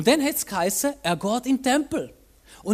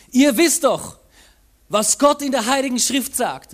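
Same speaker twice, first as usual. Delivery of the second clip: loud, raised voice calling out to the crowd; 4 semitones higher, 7 dB louder than usual. Acted. Jesus calling out to the crowds in the temple, loud and raised. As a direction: proclaim it over the whole crowd. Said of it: "rief Jesus den Menschenmengen zu".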